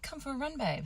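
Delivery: high, soft coaxing husband voice; husband sweetly coaxing wife to run, mock high and soft; husband's coaxing invite. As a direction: lightly mimic him coaxing her out. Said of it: "And he's like".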